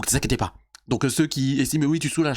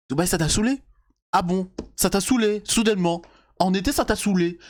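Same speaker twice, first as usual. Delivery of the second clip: incredulous; 6 semitones higher than usual. Real, not acted. Incredulous mocking of her claim.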